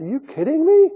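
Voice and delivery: high, loud disbelieving retort; incredulous. Retold or imitated. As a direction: scoff at the prophet in disbelief. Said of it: "looked incredulously at the prophet and said".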